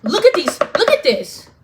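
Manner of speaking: high, loud, pointed "hold on, look at this"; mock critical thinker. To viewers, acting out checking facts, loud and pointed. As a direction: play the sharp-eyed researcher catching a lie. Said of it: "and I could be like".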